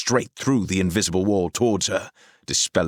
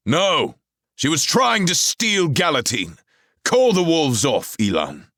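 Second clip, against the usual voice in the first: higher, loud stern command; commanding order; 11 semitones higher, 6 dB louder than usual. A narrator's reading. Arthur orders Elan to call off the wolves, loud. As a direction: order him like a king.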